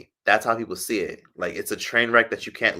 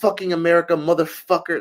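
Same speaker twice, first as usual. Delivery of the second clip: high, loud mocking shout; hammed-up yell of a stranger; mock angry yell; 9 semitones higher, 6 dB louder than usual. Man on the street yelling after her, loud mock shout. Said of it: "And he was like".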